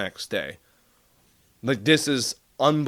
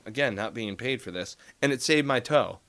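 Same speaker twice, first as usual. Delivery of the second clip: ordinary talk, nothing marked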